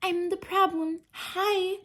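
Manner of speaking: high, nasal-free mock singer impression; mock the pop star's line, hammed up. Mocking the singer's lyric in a high put-on voice.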